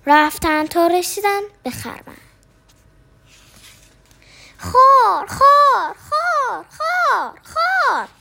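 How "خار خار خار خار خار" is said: snore climbing higher each time; mock snore rising in pitch; comic snore sounds. A narrator's reading. Snoring sound from the haystack.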